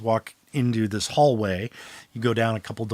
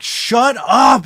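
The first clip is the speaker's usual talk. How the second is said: loud, high angry shout; shouted shut up; 9 semitones higher, 7 dB louder than usual. Acted. Demon shouting at the joker outside, loud. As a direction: yell it at the door.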